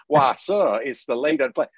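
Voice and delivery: put-on a touch of deep Southern accent on a genteel aside. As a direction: play the courtly Southern gentleman in a deep Southern accent. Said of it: "when I go into my deep Southern accent".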